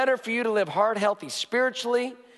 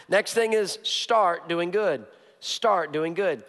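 slow, weighty statement; slow emphasis; slower than usual. Acted. Pastor announcing the second point slowly. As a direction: give it room.

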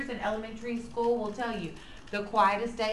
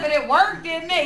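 laughing, louder, a bit higher; laughing retort; 5 semitones higher, 8 dB louder than usual. Retold or imitated. Mom laughs back at son, teasing and louder. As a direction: tease him with a laugh.

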